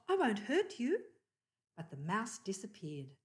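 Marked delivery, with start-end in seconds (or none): 0.1-1.0 coaxing. A narrator's reading. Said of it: "called Dot".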